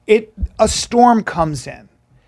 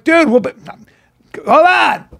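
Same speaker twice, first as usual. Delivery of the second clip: high, urgent call to a friend; retold urgent shout; 8 semitones higher than usual. Friends to the boy being swept away, urgent shout. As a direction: call out mid-scramble, pitch jumping up. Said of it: "his friends are like".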